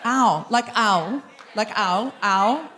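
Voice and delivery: "owl" drawn out slowly, testing the vowel; sounding out a syllable. Shown as a demonstration. Comedian asking the audience how the name starts, sounding out owl. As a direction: try "owl" as the first sound, questioningly. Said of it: "okay, break it down".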